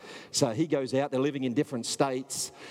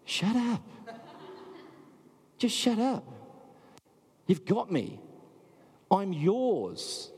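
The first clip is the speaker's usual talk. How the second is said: a loving God begging you close; God pleading tenderly; 4 semitones higher than usual. Retold or imitated. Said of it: "God's sitting there at times going".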